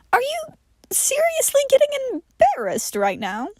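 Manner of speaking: high, disbelieving teasing voice; incredulous mock. Johnny teasing John for being embarrassed, high and incredulous. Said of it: "Johnny mocking John's embarrassment".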